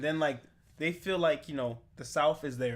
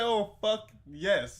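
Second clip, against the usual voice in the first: retold elation; 5 semitones higher, 4 dB louder, much slower than usual. Acts out character's elated reaction.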